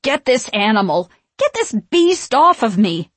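shriek. A narrator's reading. Winifred with a cat on her neck, shrieking. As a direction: shriek frantically for help. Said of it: "Winifred shrieked".

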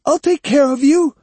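put-on high cracking yell like an old woman; cracked hoarse shout. A narrator's reading. Possessed boy threatening foxes, cracking high shout. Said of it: "His voice cracked hoarsely".

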